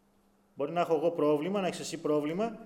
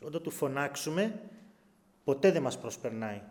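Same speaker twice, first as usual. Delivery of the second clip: ordinary talk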